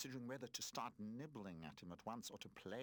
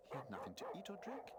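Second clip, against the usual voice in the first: ordinary delivery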